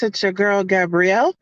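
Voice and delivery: ordinary talk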